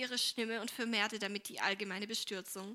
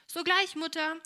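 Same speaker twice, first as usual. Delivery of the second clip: higher, louder, lively reply; eager girl; 7 semitones higher, 6 dB louder than usual. A narrator's reading. Helene dances in to help her mother, eager. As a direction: answer mother brightly, pitched up. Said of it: "sagte Helene und tänzelte".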